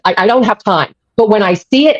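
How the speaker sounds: ordinary talk, nothing marked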